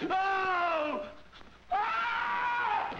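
high sudden yelp; startled cry. Acted. Surgeon startled as the body moves. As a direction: jolt out a shocked cry.

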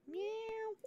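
very high soft imitated meow; tiny high kitty meow; cat meow. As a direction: mew softly like a cat. Cat meows at bedtime.

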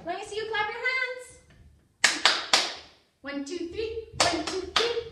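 put-on loud, quick, cheering the children on; rally the kids to clap along; loud encouragement.

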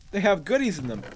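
usual speaking voice

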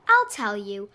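plain reading voice

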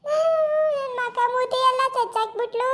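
high, very slow wailing complaint; slow lament. Acted. Beaten woman wails to the crowd about her face, slow and high. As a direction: stretch every word into a wail.

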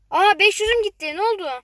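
dismayed yelp at lost coins; shocked outburst. Real, not acted. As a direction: caught off guard by the loss. Suddenly loses coins.

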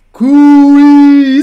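loud, high, drawn-out mooing call; cow's voice. A narrator's reading. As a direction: call out long like a cow. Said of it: "said the cow".

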